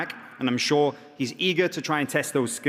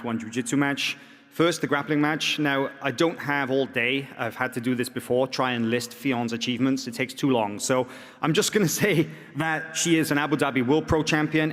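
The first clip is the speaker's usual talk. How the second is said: usual speaking voice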